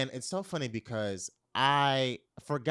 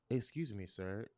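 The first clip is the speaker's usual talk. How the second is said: soft approach; 3 semitones lower, 9 dB quieter than usual. Retold or imitated. Retold soft approach from behind.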